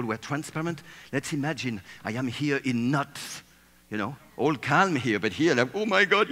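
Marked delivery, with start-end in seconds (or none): 5.7-6.3 high, loud panicked cry; a comic burst of fear; mock panic. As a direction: play the hidden legs freaking out. Legs voiced in panic under table.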